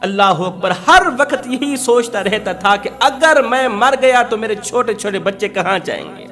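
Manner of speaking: usual speaking voice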